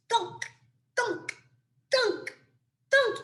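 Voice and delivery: rhythmic high-pitched thunk, thunk; bouncy thunks. Acted. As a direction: plink out each thunk like a cone landing. Pine cones hitting the bears.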